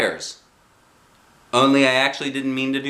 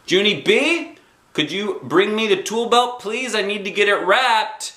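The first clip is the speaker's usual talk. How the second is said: higher, louder call down the hall; hollering mother; 11 semitones higher, 3 dB louder than usual. A narrator's reading. Mother hollering for Junie B to bring the tool belt. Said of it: "Mother hollered some more".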